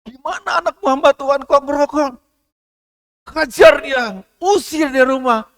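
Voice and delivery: lightly voiced inner accuser urging punishment; stern inner voice. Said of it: "mestinya kan didorong dalam batinnya".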